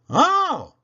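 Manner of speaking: high, loud gasp of joy; surprised Oh. Acted. Toodle spots Bill's green flag, loud high Oh. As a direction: burst out in delight.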